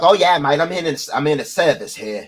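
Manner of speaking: rough attempt at thick Boston accent; imitated thick Boston accent. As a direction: mimic a thick Bostonian, not quite properly. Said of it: "his voice is so thick".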